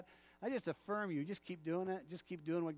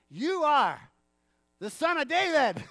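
high, loud cry out to Jesus; 7 semitones higher, 10 dB louder, slower than usual. Acted. Blind man calling out to Jesus, loud and pleading. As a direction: call it out over the crowd.

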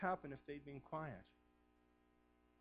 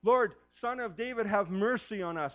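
loud, high plea; crying out; 6 semitones higher, 13 dB louder than usual. Acted. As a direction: beg at the top of your voice. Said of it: "they cried out the more".

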